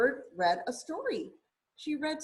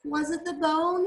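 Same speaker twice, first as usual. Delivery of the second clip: raised, higher questioning call; 6 semitones higher, 4 dB louder than usual. A narrator's reading. Rocket calls to the bird in the tree, calling out. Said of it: "he called to the bird".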